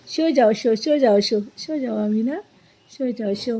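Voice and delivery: soft, repetitive hushing murmur; lulling to sleep. Real, not acted. (बच्ची को धीमी आवाज़ में सुलाना)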